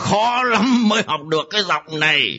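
possessed dad speaking Northern Vietnamese; Northern accent. Acted. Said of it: "ba của tôi nói giọng Bắc hồi nào vậy cà". (giọng Bắc nhẹ)

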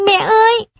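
high, loud cry of mom; a child cries out in dismay; anguished cry. Acted.

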